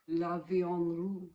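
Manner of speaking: put-on slow, drawn-out French accent on the colour name. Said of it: "do you like my French accent?".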